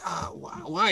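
put-on sleepy breathy whine of a woken kid; groggy whine. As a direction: whimper it, half asleep. Groggy kid woken and told to get up.

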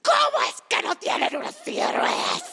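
imita un reclamo furioso, agudo y rasposo; grito enojado imitado. Retold or imitated. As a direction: grita indignado a todo pulmón, exagerando. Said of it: "jamás lo había visto tan en-, enojado". (gritando furioso, imitando a su amigo)

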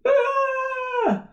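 put-on high loud child shriek; sudden high wail; shocked child scream. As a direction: shriek as if hit. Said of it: "She was like".

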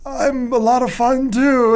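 high put-on old wizard voice; mock Dumbledore. Mocking the film's Dumbledore, high hammy voice. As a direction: play the jolly headmaster, hammed up. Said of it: "And then he's like".

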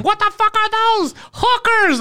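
high, slow, mock shrieking mom; mom yelling. Mom bursting in at dawn, shrieking mock outrage. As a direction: screech it like an outraged mother. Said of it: "broke in and yelled at him".

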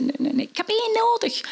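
high, flustered protest; flustered man. Acted. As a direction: wave them all off. Man overwhelmed by orphans.

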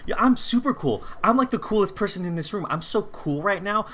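high-pitched mock boasting; hammed-up insistence, pitched well up; manic bragging. Host playing husband arguing back at his wife, manic mock bragging.

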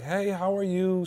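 put-on high, soft, slow old lady greeting; lightly voiced sweet old lady; a hint of a gentle old lady on the phone; calm sweet old lady. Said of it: "So calm".

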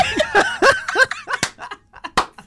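helpless laughing fit; big high laugh. Real, not acted. Cracking up at the comment.

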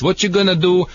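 ordinary talk, nothing marked

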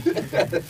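unvoiced, high breath of a laugh; breathy laugh. Real, not acted. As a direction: laugh silently at yourself. Laughs at own naive question.

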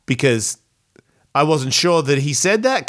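ordinary talk

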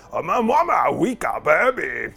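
slow, low, croaky little creature; goblin voice. Pretend. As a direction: grunt it out like a small goblin. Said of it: "Turned into Star Wars".

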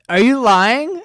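high, loud, incredulous mimicry; mock accusing question. Mimicking the girl's suspicious question.

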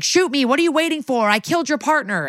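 a hammed-up cry to shoot him; mock desperate plea. Suicide by cop plea.

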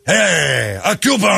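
high excited mock yell of "a Cuban"; mock shout. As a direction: bellow it like a thrilled fan. Mocking Scarface moviegoers.

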